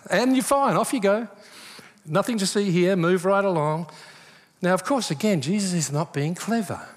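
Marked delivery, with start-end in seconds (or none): 0.1-3.9 mock breezy dismissal. Ironic brush-off after impossible demand.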